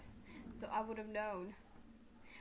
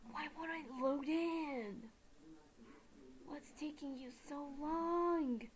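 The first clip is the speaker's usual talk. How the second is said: high, drawn-out complaint; slow, higher-pitched pleading at a stuck game; impatient whine; 4 semitones higher, slower than usual. Acted. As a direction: stretch the words in a mock-exasperated whine. Impatient plea at a stuck loading screen.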